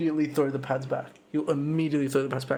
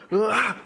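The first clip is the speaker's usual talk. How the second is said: short sharp yell, higher and a bit louder; imitated yell; 6 semitones higher, 4 dB louder than usual. Imitating the opponent's yell before the elbow. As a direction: bark out a quick fight yell. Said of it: "fully yelled".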